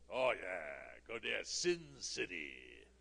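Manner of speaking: very low, drawn-out aside; playfully lecherous low voice; mock sleazy relish. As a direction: savor it slowly in a put-on deep voice. Playful aside about Las Vegas.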